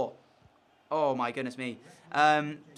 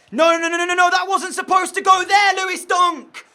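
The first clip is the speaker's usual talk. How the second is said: much higher, much louder frantic yelling of no; frustrated shout; 14 semitones higher, 13 dB louder than usual. Real, not acted. Player yelling at his defender after a costly error, frustrated shouting. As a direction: scream at the defender's mistake.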